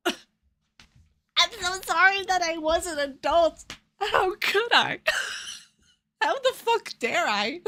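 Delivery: high, drawn-out fake contrition through laughter; sarcastic mock apology. Streamer answering a commenter's jab about missed content, sarcastically apologetic.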